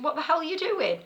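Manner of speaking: high, incredulous mock-panicky question; imitated panic. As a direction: mimic an alarmed caller demanding answers. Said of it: "he were getting panicky".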